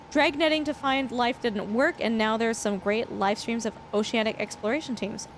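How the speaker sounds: ordinary talk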